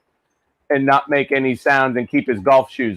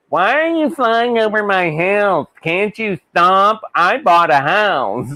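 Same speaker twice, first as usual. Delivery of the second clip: higher, whiny mock of a homeowner complaining; 5 semitones higher than usual. Son mocks jet-noise complainers, whiny mock voice. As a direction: put on a grumbling neighbour voice, slowed.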